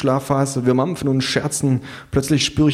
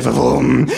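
short revving engine imitation; mouthed engine noise; lawnmower roar; 3 semitones higher, 5 dB louder than usual. A narrator's reading. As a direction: growl out a motor rev.

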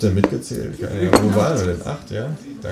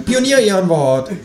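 much higher, cheeky kangaroo voice; 11 semitones higher than usual. Acted. Kangaroo suggests the cinema, high cheeky voice. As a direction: squeak it out as the kangaroo. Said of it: "sagt das Känguru".

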